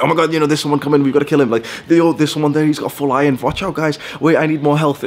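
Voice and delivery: high, urgent teammate chatter; excited gamer callouts. Acted. Young gamer warning friends on Skype during Minecraft, excited high callouts. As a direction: call out threats to your team, pitched well up.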